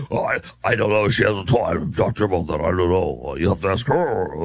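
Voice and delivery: low gravelly put-on voice. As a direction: growl the candidate's answers low. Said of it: "isn't my gravelly voice awesome?".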